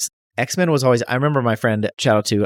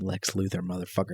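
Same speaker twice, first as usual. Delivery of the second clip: much softer exasperated mutter; 5 semitones lower, 13 dB quieter than usual. Real, not acted. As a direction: grumble it under your breath.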